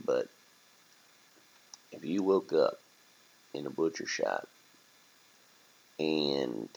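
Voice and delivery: low, soft, quick bursts between hesitant pauses; laid-back rat. Acted. Rat breaking family news to turkey, low and hesitant. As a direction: break it gently as a casual rat.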